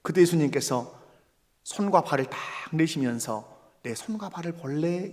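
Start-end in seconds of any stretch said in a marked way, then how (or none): 3.8-5.1 soft, tender voice of Jesus; hushed, inviting question; gentle invitation. Acted. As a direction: offer it quietly and kindly. Jesus gently offering hands and feet.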